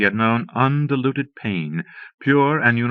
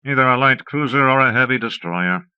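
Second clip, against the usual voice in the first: higher, louder scorn; scornful; 5 semitones higher, 4 dB louder than usual. A narrator's reading. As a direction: say it with contempt. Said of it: "said scornfully".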